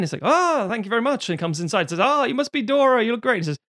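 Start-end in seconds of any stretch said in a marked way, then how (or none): 0.2-1.3 put-on Polish Yiddish accent. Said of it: "in his sort of Polish Yiddish". 2.0-3.4 put-on Polish Yiddish accent. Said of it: "in his sort of Polish Yiddish".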